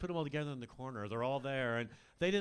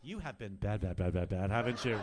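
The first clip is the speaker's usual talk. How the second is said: low flirty tease; 6 semitones lower, 6 dB louder than usual. Acted. Flirting with enemy soldier.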